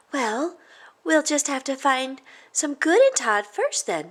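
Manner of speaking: put-on high, eager kid voice; light up with an idea; excited girl. Jessica pitching a plan to friends.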